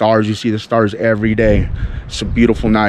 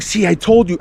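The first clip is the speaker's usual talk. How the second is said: high, thrilled I-told-you; excited told-you to wife; 7 semitones higher than usual. Retold or imitated. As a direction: crow it with rising excitement. Second falling star seen.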